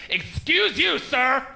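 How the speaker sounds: mock scandalized woman; offended woman. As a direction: scold him like an offended stranger. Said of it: "gets in my face and goes".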